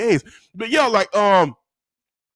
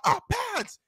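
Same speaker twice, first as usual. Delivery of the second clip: high, soft pupils' chorus; small, dutiful high voice; obedient class; 13 semitones higher, 7 dB quieter than usual. Retold or imitated. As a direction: imitate children answering in unison. Class answers teacher.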